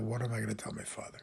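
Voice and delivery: put-on softly quoting the boy. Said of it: "that little boy who called out".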